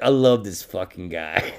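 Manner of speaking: lightly voiced talking toilet bowl; a hint of a goofy talking toilet. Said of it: "Biggie's toilet bowl impression".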